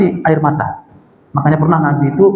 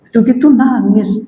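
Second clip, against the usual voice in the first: raised, dismissive mock scolding; rough husband; 5 semitones higher than usual. Rough husband belittling his crying wife, dismissive. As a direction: scoff at the tears. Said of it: "ini ciri-ciri suami kasar ya".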